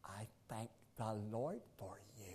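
soft whisper of gratitude; whispered thanks. Retold or imitated. Husband recalling his wife whispering thanks to him. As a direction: whisper it close and tender. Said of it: "And she said".

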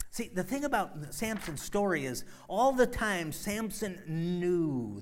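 loud, high preaching cry; preaching at full force. Acted. Preacher driving home the moral, full force. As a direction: build it to a climax.

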